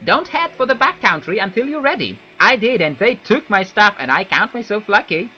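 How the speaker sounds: Wood Elf voice. Acted. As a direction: give it a gruff elf warning. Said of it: "my Wood Elf voice sounds like that".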